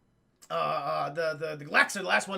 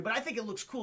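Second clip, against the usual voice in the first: ordinary talk, nothing marked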